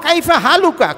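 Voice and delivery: কাইফা হালুকা said in full, careful classical Arabic; pure Arabic. Shown as a demonstration. Imam showing the congregation pure Arabic greeting, careful pronunciation. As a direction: pronounce কাইফা হালুকা as clean, complete formal Arabic. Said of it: "বিশুদ্ধ ভাষা হলো".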